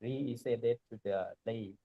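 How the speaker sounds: very soft, far from the mic; barely audible, away from the microphone; too quiet. Real, not acted. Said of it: "Some of your voice is very low".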